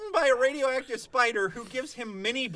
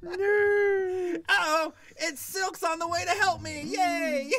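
higher, laughing hammed-up hero cheer; goofy cartoon hero; 6 semitones higher, much slower than usual. Mocking Spider-Man cameos, high laughing cheer. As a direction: play a giddy kid hero.

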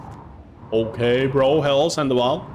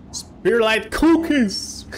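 high, slow, savoured announcement; gleeful; 11 semitones higher, 5 dB louder, much slower than usual. Real, not acted. Streamer reads out the cookie name, high and slow with glee. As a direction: savour each word like a treat.

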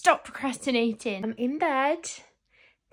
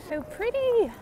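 gushing; 11 semitones higher, slower than usual. Real, not acted. Admiring a look-alike stranger.

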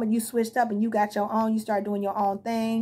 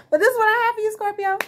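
overwrought sign-off; 11 semitones higher, 6 dB louder, faster than usual. Real, not acted. Emotional sign-off after venting.

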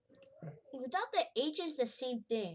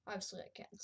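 breathy hushed aside; whispered aside; 5 semitones lower, 10 dB quieter, faster than usual. Real, not acted.

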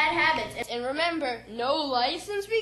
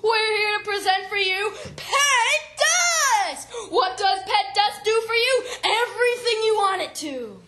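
hammy TV infomercial host; hard sell ad; 6 semitones higher, 4 dB louder than usual. Acted. Mock infomercial hosts open.

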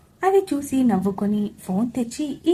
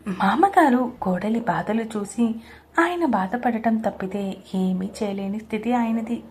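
usual speaking voice

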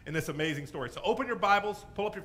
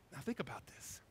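near-whisper, much softer; hushed, confidential prompt; hushed aside; 2 semitones lower, 12 dB quieter than usual. Real, not acted. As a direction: drop to a whisper to draw them in. Preacher hushes to draw listeners in.

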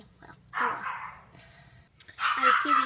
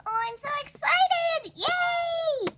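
high excited cheer; excited squeal; 12 semitones higher, slower than usual. Acted. Thrilled to go trick or treating.

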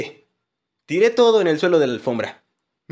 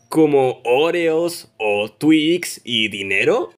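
slow, deliberate; 5 semitones lower, much slower than usual. Acted. Deadpan list of wanted gifts.

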